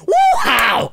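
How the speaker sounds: loud whooping yell; mock war whoop. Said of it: "just walk up to another lady and just".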